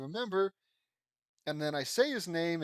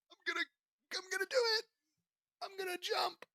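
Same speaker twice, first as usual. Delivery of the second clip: put-on much higher squeaky girl voice; high character voice; faster than usual. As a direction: pitch way up for the nervous jumper. Voicing a character mid-story.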